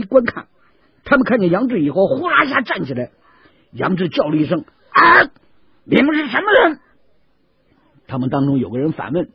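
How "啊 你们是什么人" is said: sharp, high startled challenge; startled shout. Acted. 杨志猛然看见树下的人，惊叫着喝问. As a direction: jump up and demand who they are. Yang Zhi startled by strangers.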